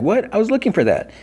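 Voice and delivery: very high mock outrage; retold indignant surprise. Nancy learning her sweater went to Goodwill, high mock indignation.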